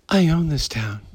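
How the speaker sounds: mock the ex-friend's soft swagger; Hollywood impression. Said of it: "this is how he talks".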